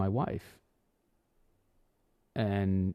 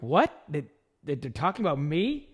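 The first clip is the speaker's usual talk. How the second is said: stammering shock; 7 semitones higher than usual. Retold or imitated. On the phone hearing the radio talked about him, stammering shock. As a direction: caught off guard, stammering.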